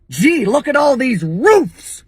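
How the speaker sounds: "roofs" high, drawn out like a dog's woof; slow, high mock-American "roofs"; mocking American pronunciation. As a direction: make "roofs" sound like a puppy barking. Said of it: "tell me you don't sound like a baby dog".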